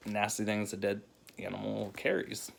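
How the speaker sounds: ordinary talk, nothing marked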